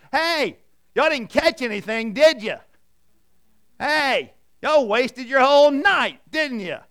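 high, loud teasing call from the shore; Jesus teasing the fishermen from the shore, loud folksy holler; folksy shout-out. Retold or imitated. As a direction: holler at them like a buddy ribbing tired fishermen. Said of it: "The phrasing is great".

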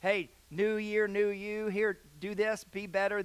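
lightly hammed-up New Year sales pitch; commercial pitch. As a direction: imitate a pushy TV commercial. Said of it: "messages on the commercial saying".

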